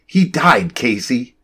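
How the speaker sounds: firm. A narrator's reading. Sarah is not finished and tells Casey firmly. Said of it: "in a firm voice said".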